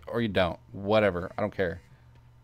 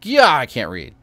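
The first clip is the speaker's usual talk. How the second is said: usual speaking voice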